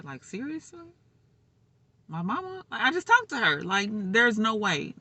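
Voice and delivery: high, loud denial of the news; frantic denial. Retold or imitated. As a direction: refuse to believe it. Retold denial of mother's death.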